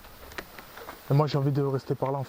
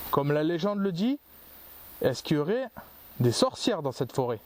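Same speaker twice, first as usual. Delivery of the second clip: higher-pitched, measured questions with long pauses; calling out to spirits; 3 semitones higher than usual. Real, not acted. Ghost hunter asks the forest's witches to answer, calling out to spirits. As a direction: address unseen listeners, pitch lifted.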